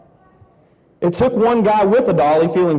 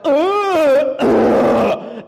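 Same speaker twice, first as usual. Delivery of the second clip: a touch of mock effort, grunting higher; straining grunts; 6 semitones higher than usual. Hoisting the heavy cauldron with one arm, mock grunts. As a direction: imitate grunting under a heavy load.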